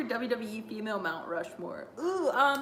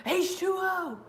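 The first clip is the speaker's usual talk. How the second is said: imitated Waterboy; 8 semitones higher, slower than usual. Quoting The Waterboy for fun, imitated freakout. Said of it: "The Adam Sandler freakouts are great".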